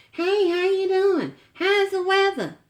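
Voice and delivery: high, sugary phone greeting; sweet phone voice. Retold or imitated. Wife imitating the aunt's sweet phone greeting. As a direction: put on a syrupy sweet voice. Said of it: "That's, that's Miss Chitlin Salad".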